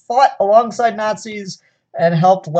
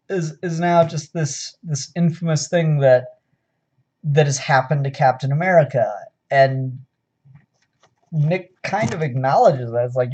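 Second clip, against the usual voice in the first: normal talking voice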